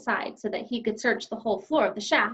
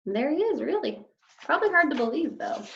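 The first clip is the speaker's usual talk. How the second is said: usual speaking voice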